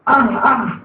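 short breathy groans of pain; demonstrated pain groan. Shown as a demonstration. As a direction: show the sound of pain, ah ah. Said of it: "kalau sakit itu ah".

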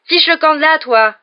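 high, very loud angry shout; roaring. A narrator's reading. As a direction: roar it to chase someone off. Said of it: "rugit Ron".

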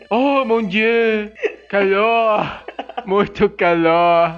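put-on slow, drawn-out French-accented sighs; bored French woman. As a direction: languid French lady complaining of heat. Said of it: "Você é uma francesa entediada".

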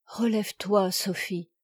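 tender and hushed; gentle. Acted. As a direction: tell the child softly, with kindness. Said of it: "avec douceur".

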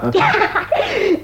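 szczery, głośny śmiech; śmiej się serdecznie z jego upadku; wybuch śmiechu. Acted. Śmieje się z wyrzuconego stryja. (śmiech z radości, że wuj pokonał stryja)

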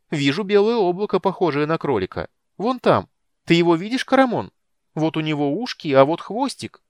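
high, loud, eager kender voice; Tasslehoff. A narrator's reading. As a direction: pipe it out excitedly, high and ringing. (звонкий, восторженный голос кендера)

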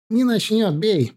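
confident. A narrator's reading. Comrade telling the marksman to shoot, confidently. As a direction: brush the worry aside with certainty. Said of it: "проговорил тот уверенно".

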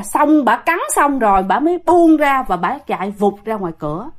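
usual speaking voice

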